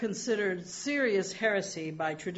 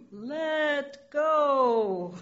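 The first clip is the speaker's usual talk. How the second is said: higher, louder mysterious command; 7 semitones higher, 5 dB louder than usual. Retold or imitated. Mysterious voice tells the dangling man to let go, raised. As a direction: deliver it as a calm order from above. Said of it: "The voice says".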